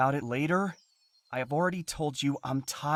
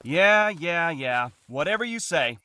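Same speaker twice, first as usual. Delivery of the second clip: sarcastic dismissal; 7 semitones lower, 10 dB louder than usual. Acted. Man dismissing his partner's reproach, loud and sarcastic. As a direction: wave the complaint away, loudly and scornfully.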